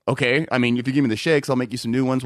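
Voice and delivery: low, matter-of-fact imitation; lightly voiced boss. Boss offers complaining customers new shakes, lightly voiced, low and even. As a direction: play a reasonable manager offering a fix. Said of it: "And my boss goes".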